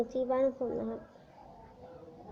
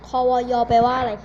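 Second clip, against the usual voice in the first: much louder, shouting the question across the room; 10 dB louder than usual. Real, not acted. Streamer yelling a crude quiz question at viewers, loud shout. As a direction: yell it out like a rowdy quiz.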